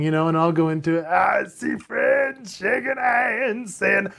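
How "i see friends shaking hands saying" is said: slow, high gravelly vocal fry voice; sing-speak it slowly with heavy vocal fry. Shown as a demonstration. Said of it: "Think about the voice of [name]".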